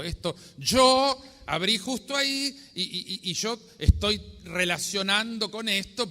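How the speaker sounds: high, loud, tumbling protest; agitated disbelief. Retold or imitated. Priest arguing with himself over the coincidence, loud agitated disbelief. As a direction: talk yourself out of it, getting worked up.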